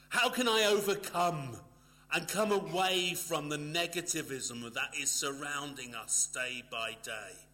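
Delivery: loud, high, driving pulpit voice; forceful preaching. Acted. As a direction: preach it out to the hall with full force. Preaching climax.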